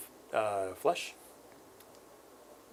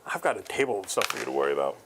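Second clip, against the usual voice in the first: normal talking voice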